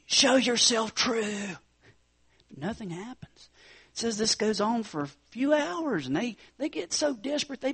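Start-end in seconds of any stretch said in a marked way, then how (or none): 0.1-1.6 crying out to gods. Retold or imitated. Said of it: "as they cry out to them".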